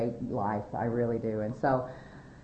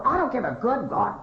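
high, mock angry yell; screaming dad; 8 semitones higher, 4 dB louder than usual. Dad refusing to bail out daughter, retold angry yell. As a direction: imitate an exasperated dad hollering. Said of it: "he's screaming his head off".

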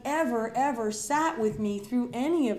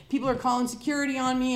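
ordinary talk

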